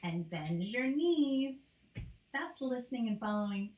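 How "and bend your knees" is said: slow, clear two-step instruction; slow instruction to a child; slow direction. Shown as a demonstration. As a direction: give the command slowly and clearly. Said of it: "You could tell them".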